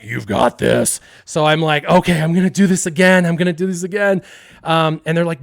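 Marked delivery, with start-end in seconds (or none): 0.0-1.0 loud, put-on egging voice; pride talking. Pride pushes the kid to retry, loud put-on voice. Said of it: "Pride's like". 1.9-4.2 loud, higher self-rallying; determined. Acted. Kid commits to riding again, loud and determined.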